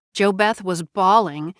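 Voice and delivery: ordinary delivery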